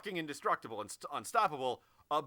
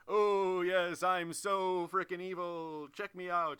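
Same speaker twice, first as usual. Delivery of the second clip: slow, hammy, louder evil gloating; mustache twirling villain; 3 semitones higher, 5 dB louder, slower than usual. Retold or imitated. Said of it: "he's in full mustache-twirling evil mode".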